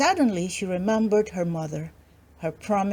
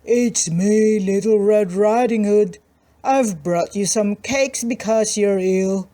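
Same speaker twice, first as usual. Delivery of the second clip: wolf hiding gruff voice; 4 dB louder than usual. Acted. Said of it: "trying hard to hide his gruff voice".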